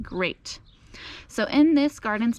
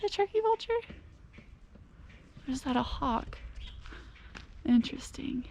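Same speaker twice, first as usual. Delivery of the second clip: whisper; 4 semitones higher, 7 dB quieter than usual. Real, not acted. Watching the bird overhead.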